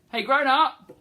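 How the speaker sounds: loud high call. Real, not acted. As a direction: shout across the room. Calling out to grown-up.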